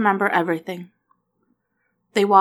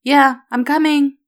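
louder, higher call back; boy calling back to his friend; 7 semitones higher, 8 dB louder than usual. A narrator's reading. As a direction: shout back happily. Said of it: "Will called back".

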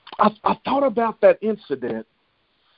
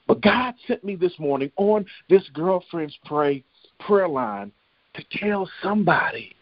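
ordinary talk, nothing marked

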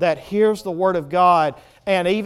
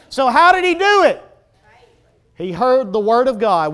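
loud, impassioned sermon delivery; full-force preaching; 6 semitones higher, 9 dB louder than usual. Real, not acted. Preacher questions congregation about the lame man, full-force preaching. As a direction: drive the questions home to the room.

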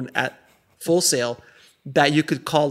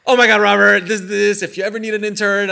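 louder and higher, gushing at a hero; gushing rant; 7 semitones higher, 9 dB louder than usual. Retold or imitated. Young fan pleading into a voicemail, gushing rant. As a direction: babble like an overeager fan. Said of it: "I just go off on this rant".

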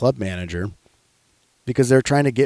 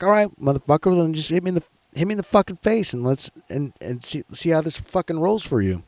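mock challenge; 7 semitones higher than usual. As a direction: goad him in a raised, strained-up pitch. Retelling his challenge to aggressive man.

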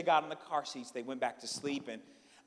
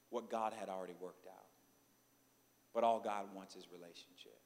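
low, soft, solemn sermon delivery; hushed preaching; 8 semitones lower, 14 dB quieter than usual. Real, not acted. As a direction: press each truth home quietly.

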